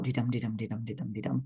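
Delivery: singsong "de-dum" with every "dum" stressed, fading; mechanical iambic beat. Shown as a demonstration. Teacher showing how not to read it, rigid "de-dum" beat. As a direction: recite "de-dum" like a metronome. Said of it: "You don't read it out".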